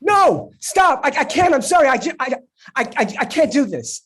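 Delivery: high loud frantic refusal; panicked. Acted. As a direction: beg him to stop, flustered.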